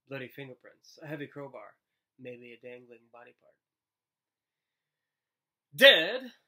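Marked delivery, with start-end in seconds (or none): 5.7-6.3 high, loud incredulous cry; a teacher amused and startled at the idea; surprised. Acted. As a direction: toss the word back in disbelief.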